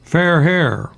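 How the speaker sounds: slow, savoured punchline, "Fair hair" lifted; poem punchline. Acted. Host delivers his two-line poem to the radio audience, slowly. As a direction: deliver it like a pun. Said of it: "Are you ready for the poem now".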